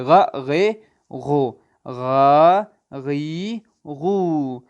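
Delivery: each vowel of غ drawn out slowly and gutturally; letter sounds sounded out for pupils; sounds of ghain. Shown as a demonstration. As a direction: sound out غ، غو، غا، غی one at a time, stretched. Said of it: "غین کی چھ آوازیں".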